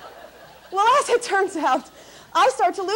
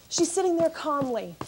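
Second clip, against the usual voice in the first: usual speaking voice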